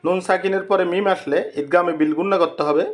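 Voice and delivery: normal talking voice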